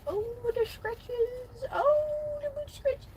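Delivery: squeaky high pet-talk voice. Real, not acted. Fussing over dog's boot scratches.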